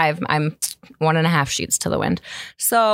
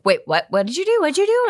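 shocked; 9 semitones higher than usual. Retold or imitated. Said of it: "she was like".

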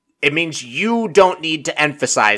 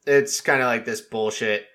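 usual speaking voice

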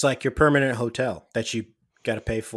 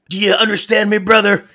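high, loud Hulk-style promo; wrestler promo voice; 7 semitones higher, 6 dB louder than usual. Retold or imitated. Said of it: "This is Brian saying".

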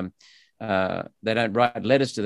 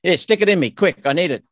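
quick, louder, higher eager voice; eager vaccine taker; 5 semitones higher, 4 dB louder than usual. Retold or imitated. Voicing an eager vaccine taker to the host. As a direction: play the keen one who wants the jab now. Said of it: "One, one response is vaccine compliant".

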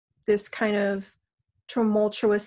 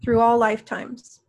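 guided meditation. Acted. Guides a healing session.

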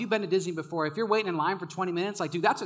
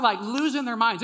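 ordinary talk, nothing marked

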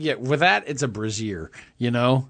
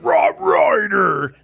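higher, shouted and slow name; imitating loud announcer; 8 semitones higher, 4 dB louder, much slower than usual. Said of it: "and then I heard".

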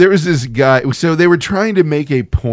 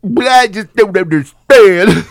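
slow, whiny mopey complaint; droopy dog guy; 5 semitones higher, 7 dB louder, slower than usual. Retold or imitated. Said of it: "He's like".

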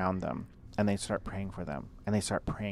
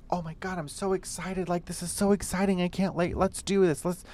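much higher, bubbly excited imitation; gushing excitement; 10 semitones higher than usual. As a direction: squeal it like an overexcited friend. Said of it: "someone is just like".

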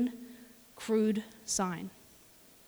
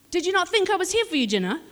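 exasperated scolding; 7 semitones higher, 3 dB louder, faster than usual. Retold or imitated. Boss scolds kid for not showing.

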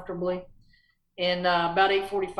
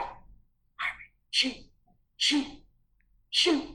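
hushed, rising urgency, shoot shoot shoot; a hunter's hissed whisper building up; urgent whisper; 6 semitones higher than usual. Retold or imitated. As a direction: whisper it, getting more urgent each time. Urging sleeping brother to shoot.

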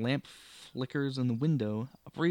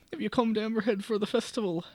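higher lilting Irish question; Irish landlady; 7 semitones higher than usual. Acted. May asking the guest about the festival, Irish lilt. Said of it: "let me go full Irish".

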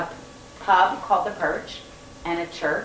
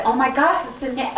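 astonished gasp; 6 semitones higher than usual. Retold or imitated. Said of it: "And I said".

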